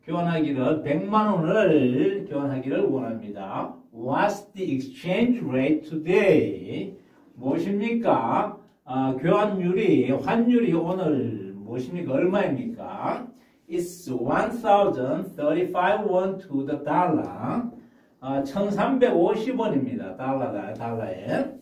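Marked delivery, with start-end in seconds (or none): 3.9-7.0 slow, careful English question; teacher demo read; slow model reading. Shown as a demonstration. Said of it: "먼저 제가 한번 읽고". 13.7-17.6 slow, careful English sentence; teacher demo read; slow model reading. Shown as a demonstration. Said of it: "먼저 제가 한번 읽고".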